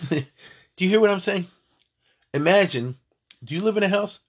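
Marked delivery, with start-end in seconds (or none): 0.1-1.5 pressing the point. Real, not acted. Teacher presses point to class.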